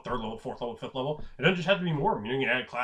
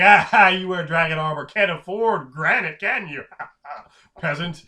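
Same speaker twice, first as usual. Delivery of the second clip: loud, jeering taunt with laughs; mocking laughter; 3 semitones higher, 9 dB louder than usual. Snob taunting a peasant in dragon armor, mocking laughter. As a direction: laugh scornfully down at them.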